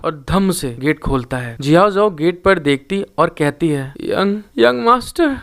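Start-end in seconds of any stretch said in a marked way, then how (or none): 4.0-5.4 high, slow tearful stammer; a weeping cry of relief. A narrator's reading. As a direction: choke out his title through tears. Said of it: "और वो जोर से रो रही होती है".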